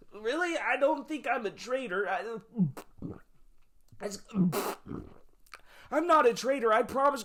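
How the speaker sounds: high-pitched nervous pleading; hammed-up panic, about to be sick; panicked denial. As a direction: plead innocence in a high, halting voice. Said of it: "shivering and shaking and freaking out".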